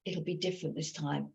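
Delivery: hushed, coaxing murmur; tempting soft voice. Retold or imitated. Wine witch tempting the drinker, hushed and coaxing. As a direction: lure them softly. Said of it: "that voice of".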